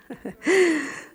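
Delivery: a brief amused laugh; short laugh. Real, not acted. Teacher laughing with the group about running out of time, light laugh. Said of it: "Qué coraje".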